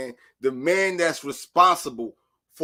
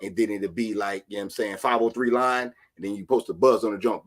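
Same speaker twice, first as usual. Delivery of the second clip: ordinary talk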